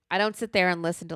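ordinary talk

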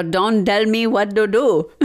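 put-on Indian accent with Ds for Ts; mock Indian accent, every T hardened to D. Shown as a demonstration. Showing a friend the skit's trick, imitating an Indian accent. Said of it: "speaking an Indian accent".

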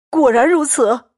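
put-on boisterous, fawning drunk bully, laughing as he talks; a tipsy braggart wheedling his friend to stay; drunken Xue Pan. Drunk Xue Pan grabs Xianglian and begs him to stay, boisterous and fawning.

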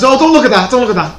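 mock flustered. Host hides his prop from the camera, mock flustered. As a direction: brush it off like you're caught out. Host hiding embarrassing item.

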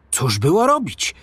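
ordinary delivery, nothing marked

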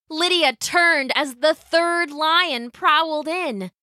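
plain reading voice

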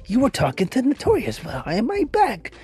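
mock betrayed.

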